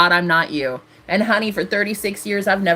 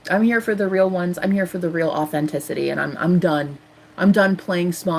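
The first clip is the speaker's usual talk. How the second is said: ordinary talk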